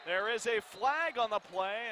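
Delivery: usual speaking voice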